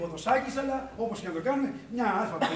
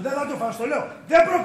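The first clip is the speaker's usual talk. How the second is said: raised voice climbing to a shout; angry defiance; 6 semitones higher, 8 dB louder, faster than usual. Acted. Owner refusing to lose his factory, angry defiance. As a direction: defy him, louder with each phrase. Accuses friend of grabbing factory.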